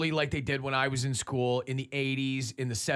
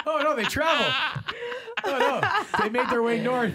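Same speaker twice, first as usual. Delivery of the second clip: laughing; 11 semitones higher, 4 dB louder than usual. Real, not acted. Laughing hard at the joke.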